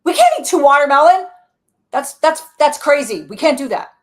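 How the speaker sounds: loud, high, alarmed protest; high and loud, clutching at control; alarmed manager part. Acted. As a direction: play the panicky inner manager. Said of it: "my main manager here, Green, is like".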